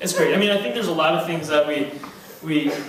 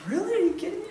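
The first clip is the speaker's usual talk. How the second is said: mock disbelief; 13 semitones higher, 5 dB quieter, much faster than usual. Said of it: "I'm like".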